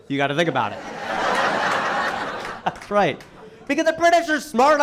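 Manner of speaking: ordinary talk, nothing marked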